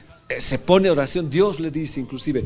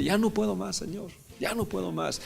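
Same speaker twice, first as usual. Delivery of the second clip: put-on softer, like a child giving up; quiet childlike plea; 6 semitones higher, 8 dB quieter than usual. Preacher showing the congregation a childlike surrender to God, soft and pleading. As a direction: ask it the way a tired child would. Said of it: "es como ir como un niño y decir".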